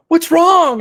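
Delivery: high, loud imitation of worried friends; mock alarm. Friends asking the screaming actor, acted-out high, loud alarm. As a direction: cry out like a panicked friend on a call.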